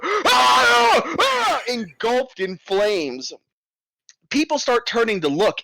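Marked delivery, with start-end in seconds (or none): 0.0-1.6 high panicked screaming; burning giant screams. Acted. Giant guard in flames running, high shrieking screams. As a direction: shriek while engulfed in flames. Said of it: "This just nine-foot Goliath goes".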